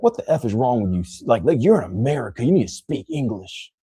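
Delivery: mock-indignant American scolding a foreigner; lightly put-on voice of a scolding local. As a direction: act out an intolerant American telling someone off. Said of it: "America would be like".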